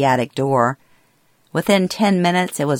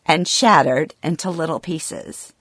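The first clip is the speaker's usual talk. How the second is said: ordinary delivery, nothing marked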